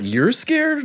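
put-on high incredulous child voice; surprised kid. Son surprised dad is scared, high kid voice. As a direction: ask it like a stunned little boy.

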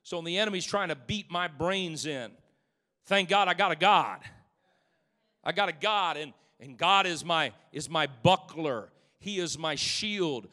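rising preacher fervor; preaching swell. Acted. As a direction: build it up to a shout of praise. Preaching builds again.

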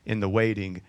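soft, low read of James; quiet scripture read. Acted. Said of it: "James says".

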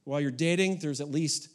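usual speaking voice

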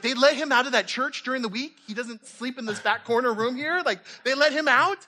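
high-pitched, astonished questioning; mock incredulous. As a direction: act out a baffled onlooker. Imagined churchgoers astonished to see him.

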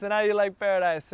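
put-on a bigger go at the Jamaican accent. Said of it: "that's my Jamaican accent".

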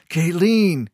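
loud, high, falling call of her name; almost a shout. A narrator's reading. Keo stopping Kayleen as she kisses him, almost shouting. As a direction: raise it nearly to a shout to stop her. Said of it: "almost a shout".

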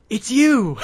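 high, loud playful yell; mock shout. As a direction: shout it out as a joke. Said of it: "Mister Bear come up and go".

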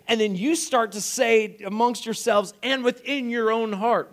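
preacher dramatising the crowd's alarm; excited storytelling sermon. Real, not acted. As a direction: paint the chaos of the roof caving in. Lively preaching retelling.

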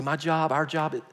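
ordinary delivery

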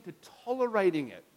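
usual speaking voice

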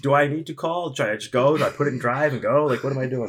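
high, fast mock panic, laughing through it; panicked friend. Retelling the friend's panic at the car, high and frantic. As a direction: ham up the frantic questions.

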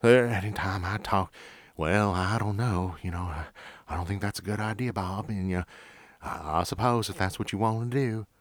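slow, lightly voiced Texas country accent; a head-shaking old Texan sergeant, in a Texas accent; Texas drawl. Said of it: "he talked just like this".

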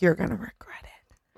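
hushed, unvoiced menace; whispered threat. Walter warning Norma as he leaves, whispered.

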